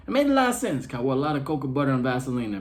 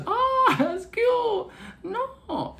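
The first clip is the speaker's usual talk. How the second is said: mock-patronizing coo, pitched way up; cooing clerk; much slower than usual. Hotel clerk doubting his Chinese name, cooing high. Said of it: "She was like this".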